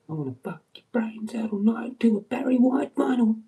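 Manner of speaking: high, loud hammed-up seduction; cheesy crooner come-on; mock seductive line. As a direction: ham up a cheesy lover's come-on.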